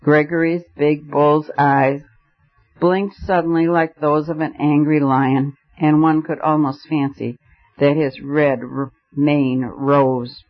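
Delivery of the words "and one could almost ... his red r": ordinary delivery, nothing marked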